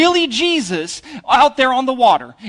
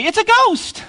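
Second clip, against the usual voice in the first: mock scared cry; 12 semitones higher, 3 dB louder than usual. Panicked disciples quoted.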